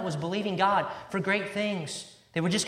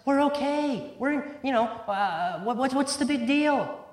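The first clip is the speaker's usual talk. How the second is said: higher, faster, brushing it off; self-satisfied shrug of a voice, pitched up; smug self-righteous voice; 6 semitones higher, slower than usual. Acted. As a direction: play the smug churchgoer who sees no problem. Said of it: "None was calling upon the name of God".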